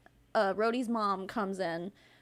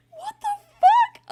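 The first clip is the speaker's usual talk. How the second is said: very high, loud, slow; mock stunned disbelief; 11 dB louder than usual. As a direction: stretch out the shock. Disbelief at dog's recovery.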